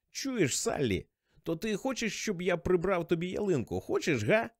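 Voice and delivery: put-on high, wheedling drunk voice; drunken pestering. A narrator's reading. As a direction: coax for an answer like a stubborn drunk. Drunk caller keeps pestering Sally.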